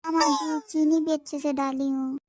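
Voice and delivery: loud eager bahu voice, got the wrong word; loud reply. Acted. Deaf bahu answers loudly again.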